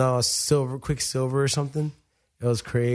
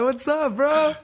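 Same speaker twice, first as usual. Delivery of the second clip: high, pumped-up hello; eager greeting; 12 semitones higher, slower than usual. Retold or imitated. Fighter hailing the surfer, high eager greeting. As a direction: greet your idol like a buddy.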